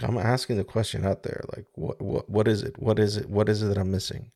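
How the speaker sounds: ordinary talk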